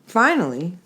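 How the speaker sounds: ordinary delivery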